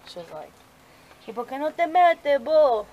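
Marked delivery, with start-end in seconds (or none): 1.2-2.8 a light, slightly higher take on a friend's speech pattern; imitating a friend's way of talking. Mom mocking how her friend talks, lightly imitated. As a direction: mimic how your friend talks, a bit louder and higher. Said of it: "I have a friend who talks like that".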